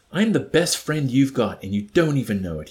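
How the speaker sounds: loud, combative insistence; belligerent. Acted. Wickham insisting to the chaplain, belligerent and loud. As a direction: push it at him like a challenge. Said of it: "asserted belligerently".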